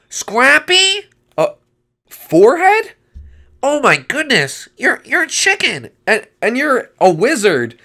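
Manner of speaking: high, loud disbelief; astonished recognition. Acted. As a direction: cry out as if seeing a lost friend. Recognises a long-lost friend.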